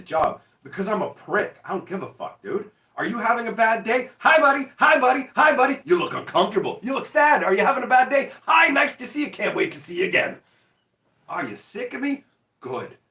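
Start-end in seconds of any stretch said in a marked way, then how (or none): 3.0-10.4 high, loud fake-friendly greetings; sarcastic hi buddy over and over; mock cheerful taunt. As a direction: sweetly needle someone you dislike. Taunting an imaginary neighbour.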